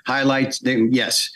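ordinary talk, nothing marked

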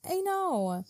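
high-pitched, commiserating 'I know'; high and drawn out; drawn-out high agreement. Real, not acted. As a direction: agree in a high sympathetic lilt. Commiserating over bad dates.

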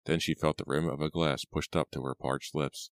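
ordinary delivery, nothing marked

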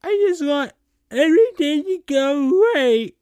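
high, loud falsetto whimper; mock crying. A narrator's reading. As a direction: break into hammed-up tears, high-pitched. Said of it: "Amy started to cry again".

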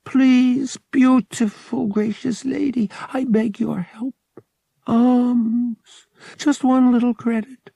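very high, wheedling beggar's whine; whining. A narrator's reading. Young beggar pleads for alms from a lady, whining. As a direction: beg pitifully, high and whiny, drawing out the end. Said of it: "he whined".

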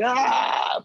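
high startled mock yelp; anxious yelp. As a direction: yelp like your heart jumps. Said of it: "my anxiety was jumping".

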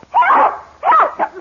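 piercing high scream for help. Acted. Attacked woman screams loudly for anyone to help. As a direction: scream it with all your breath.

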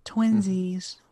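put-on sing-song the word like a giddy teen; cutesy twinsies. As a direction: Twinsies chirped up high and cutesy.

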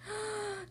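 sharp storyteller's gasp. Acted. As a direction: suck in air in sudden alarm. Broom suddenly breaks.

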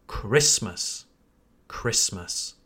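"Christmas" as a low, falling KRISS-məs; silent T. Shown as a demonstration. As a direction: say "Christmas" without any T. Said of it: "then it's better to say".

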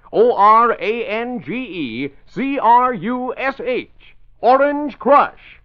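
slow, high, drawn-out brand call; slow spelled-out slogan. Acted. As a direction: spell it out like a jingle. Spelled-out brand jingle tag.

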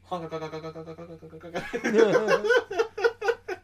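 high, loud cackling goose laugh; honking laugh. Real, not acted.

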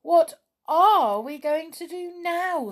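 high, loud cry of dismay; storybook dismay, pitched well up. A narrator's reading. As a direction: exclaim in alarm, drawing the words out. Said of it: "said Binky in dismay".

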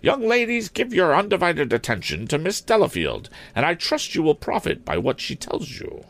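put-on high-pitched, formal old maid principal; stern schoolmistress. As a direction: address pupils and intruders in a high headmistress voice.

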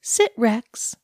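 firm command to a dog; mild dog-training command. Acted. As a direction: order him to sit like a pet. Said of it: "she commanded".

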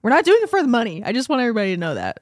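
usual speaking voice

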